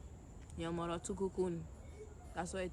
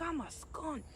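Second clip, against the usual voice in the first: with your chest; 9 semitones higher, slower than usual. Shown as a demonstration. Coaching a clueless stranger to say it with their chest, high-pitched. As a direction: demonstrate it high, drawing out the key word. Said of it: "with your chest. Be like".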